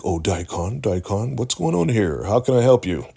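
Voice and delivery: put-on eager boss stepping in; officious supervisor. River playing supervisor in the kitchen, hammed-up officious greeting. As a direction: voice climbing high and loud. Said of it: "like he's the supervisor".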